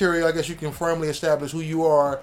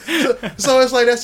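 laughing; 6 semitones higher, 9 dB louder than usual. Real, not acted. Laughing at own joke.

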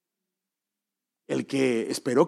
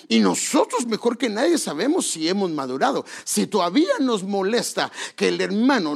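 preaching at full force; 7 semitones higher than usual. Acted. Preacher urging the church to mature, fervent and raised. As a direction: drive it home to the congregation.

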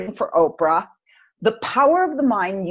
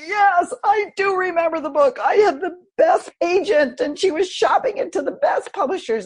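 eager reply; 9 semitones higher than usual. Retold or imitated. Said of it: "And I'm like".

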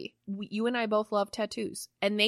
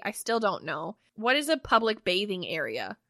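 usual speaking voice

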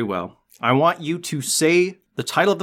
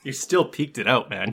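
ordinary talk

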